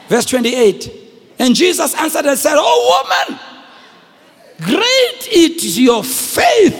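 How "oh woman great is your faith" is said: slow, loud and ringing proclamation of her great faith; Jesus' praise. A narrator's reading. Jesus praising the determined woman, slow and ringing. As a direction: draw out each word like a blessing.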